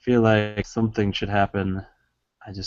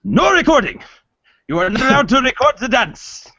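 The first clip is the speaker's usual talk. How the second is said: high, loud, indignant shout at the camera; mock outrage; 14 semitones higher, 4 dB louder than usual. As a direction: pretend to be furious about being filmed. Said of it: "you're like".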